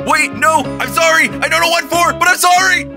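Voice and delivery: high, frantic apology; panicked pleading. Acted. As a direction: beg for mercy at the top of your pitch. Pleading while under attack.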